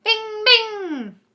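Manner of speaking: high loud ringing bing; ringing gunshot. A narrator's reading. Narrator voicing the magic pistol, high and loud. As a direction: ring out the shots.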